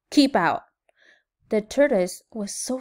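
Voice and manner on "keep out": loud, short warning; mild storybook yell; grumpy yell. A narrator's reading. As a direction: order a trespasser away. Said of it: "yelled Hank".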